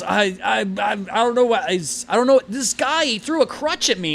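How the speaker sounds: high, loud, stammering protest; flustered stammer. Acted. Delivery driver blaming a stranger to his boss, flustered stammering. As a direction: stumble over the start, then blame someone else in a high voice.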